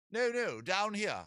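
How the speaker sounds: very high, slow British seagull; British accent. Acted. Seagull calling up to a tall man, British accent. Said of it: "give you a British accent".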